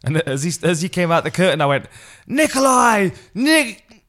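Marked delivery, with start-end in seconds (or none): 2.3-4.0 high, loud ringside call-out; loud stage announcement; loud announcer call. Retold or imitated. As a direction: call the name out to the arena.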